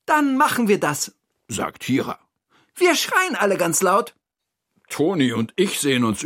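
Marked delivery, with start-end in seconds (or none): 0.1-1.1 high, brisk character voice; decisive Hira. Acted. Hira agrees to the screaming idea, high and decisive. Hira's figure voice. 2.7-4.1 high, eager character voice; rally the friends in a high voice; decisive Hira. Acted. Hira rallies friends to scream, high and decisive. Hira's figure voice.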